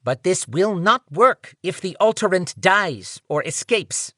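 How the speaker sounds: high nasal male voice. Acted. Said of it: "nasal voice said".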